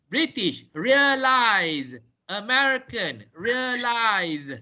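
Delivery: slow, drawn-out organize, authorize, realize with a voiced z ending; careful pronunciation demo. Shown as a demonstration. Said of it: "I'm going to pronounce these words".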